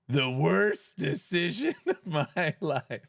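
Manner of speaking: slow, drawn-out line broken by laughter; laughing mock lament. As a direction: try to stay dramatic but crack up. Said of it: "I'm crying already".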